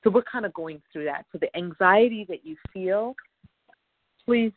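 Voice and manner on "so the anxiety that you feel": usual speaking voice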